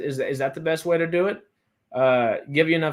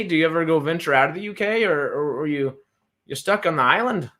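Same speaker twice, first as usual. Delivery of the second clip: put-on playful Irish accent, raised pitch; 4 semitones higher than usual. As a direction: ask it in a put-on Irish lilt. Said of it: "using the Irish accent".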